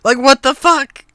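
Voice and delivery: loud, disbelieving what the fuck; exasperated outburst. Real, not acted. Streamer ranting about the studio's bundles, loud and exasperated. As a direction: blow up in frustrated disbelief.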